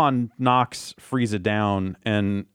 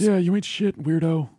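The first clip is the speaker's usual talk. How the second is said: higher-pitched mock insult; playfully acted put-down; 6 semitones higher than usual. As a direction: imitate someone belittling a weakling. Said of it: "calling him weak and mocking him".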